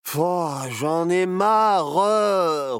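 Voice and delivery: loud, slow, whiny grumble; sulky boy grumbling he's bored. Acted. Grognon d'un garçon qui s'ennuie. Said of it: "ronchonnait Barnabé".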